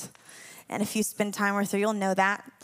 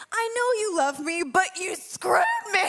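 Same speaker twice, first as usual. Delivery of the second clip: half-laughing mock whine; 8 semitones higher than usual.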